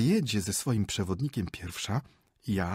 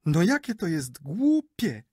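higher, louder, slow huffy reply; offended retort; 9 semitones higher, 6 dB louder, slower than usual. Acted. Wife takes offence at her husband's sneer, high and huffy. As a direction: drag it out, affronted. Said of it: "obraziła się Natalia Michajłowna".

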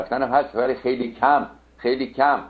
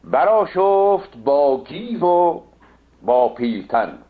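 epic declamation; 3 semitones higher, much slower than usual. Acted. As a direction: declaim the king's fury in drawn-out verse. Recites epic verse. (دکلمهٔ حماسی، بلند و کشیده)

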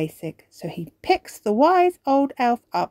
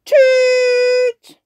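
very high, loud tooted horn sound; 11 dB louder than usual. Acted. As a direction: toot like a tiny elf horn. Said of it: "They blow their elf horns".

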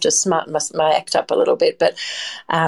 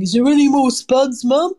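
mock kid's plea, pitched much higher and a little louder; sons begging for spuds; 8 semitones higher, 4 dB louder than usual. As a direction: imitate the hungry boys asking for more, voice pushed up high. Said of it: "a lot of boys that peer down the back of my oven saying".

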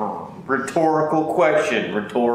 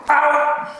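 yelp; 12 semitones higher than usual. Real, not acted. Bitten, yelps in pain.

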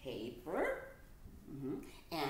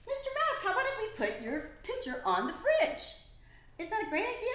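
excited suggestion; 5 dB louder than usual. Acted. Proposing fridge excitedly.

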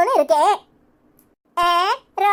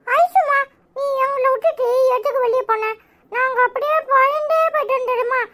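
put-on high-pitched child voice, upset and pleading; worried little child; 6 semitones higher than usual. Child to mother who left the children behind, anxious pleading. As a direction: plead like a small child who was scared.

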